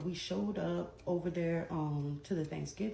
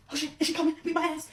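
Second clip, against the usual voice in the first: mock nervous whisper-scared; 9 semitones higher, 4 dB louder, faster than usual. Imitating nervous sister in garage.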